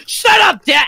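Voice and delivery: high, sudden mock outburst; angry Bakugo. Bakugo telling Deku off, hammed-up anger. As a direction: snap it out high as an angry anime rival. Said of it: "Bakugo is always angry at Deku's".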